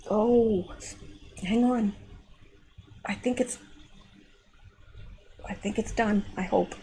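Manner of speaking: quiet strained voice in pain; through cramp pain. Real, not acted. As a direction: hold the words tight through pain. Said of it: "I got a foot cramp".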